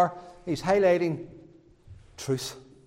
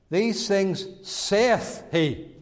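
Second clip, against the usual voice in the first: SAITH stretched out slowly and set apart; stress on SAITH; much slower than usual. Shown as a demonstration. Preacher showing where he puts the emphasis, stress on "saith". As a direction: dwell on "saith", drawing it out. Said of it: "I put the emphasis on the saith".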